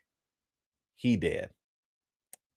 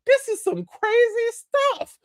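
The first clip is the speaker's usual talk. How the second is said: very high, squeaky bewildered clown; bewildered Pennywise. Retold or imitated. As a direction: squeal it like a baffled cartoon clown. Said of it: "And Pennywise like".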